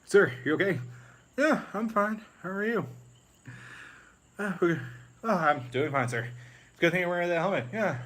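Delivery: high, put-on comic voices; chirpy officers checking on each other; Spaceballs scene. Officers check on their dazed commander, high comic voices.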